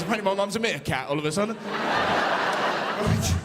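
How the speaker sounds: ordinary delivery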